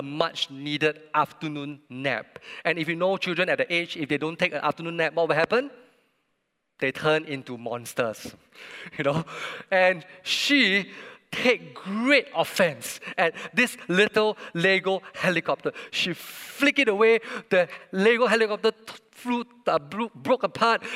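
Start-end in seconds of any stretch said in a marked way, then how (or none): none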